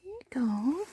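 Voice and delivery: high, gentle coaxing; cooing to a scared pet. Real, not acted. Coaxing the sheep.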